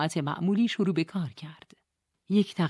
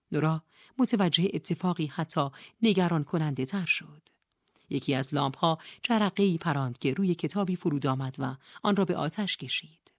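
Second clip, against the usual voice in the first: ordinary delivery, nothing marked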